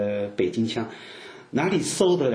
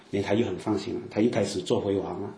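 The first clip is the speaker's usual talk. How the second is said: normal talking voice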